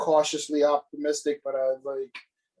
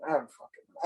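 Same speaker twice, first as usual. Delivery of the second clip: exasperated breathy mutter; 7 dB quieter than usual. Real, not acted. Exasperated rant about the movie.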